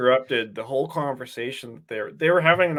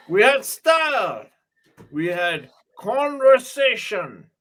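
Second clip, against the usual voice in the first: hammed-up villain, lines pitched higher; Merovingian rant; 4 semitones higher than usual. Parodying the Merovingian's rant to a friend, hammy. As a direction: overact a pompous lament. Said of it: "He says".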